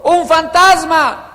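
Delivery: high, loud, drawn-out cry of alarm; hammed-up frightened shout; fearful cry. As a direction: cry out as if seeing a ghost. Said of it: "Y dieron voces de miedo".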